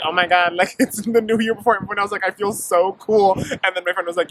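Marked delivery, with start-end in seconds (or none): 0.0-2.0 high, giddy imitation of yourself; mock gushing, pitched up; retold excitement. Retold gleeful text to friend. 2.3-3.3 high, drawn-out mock boast; slow, pitched-up self-mocking brag; retold boast.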